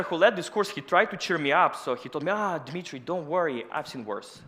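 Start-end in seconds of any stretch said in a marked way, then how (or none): none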